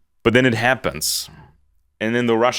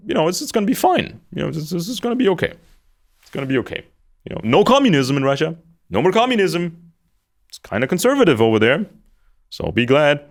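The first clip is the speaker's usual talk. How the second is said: mock reassurance; 8 semitones higher, faster than usual. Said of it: "convince the conservatives".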